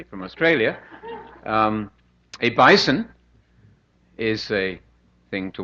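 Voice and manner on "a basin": basin said as bison, Australian style; higher, punched Australian vowel on bison; Australian accent. Shown as a demonstration. Joking about Australian speech, Australian accent. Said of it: "that in Australia".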